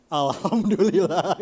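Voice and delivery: loud, drawn-out praise with a laugh; joking, exaggerated thankfulness; mock grateful relief. Acts out relief after accidental gulp. (syukur pura-pura sambil tertawa)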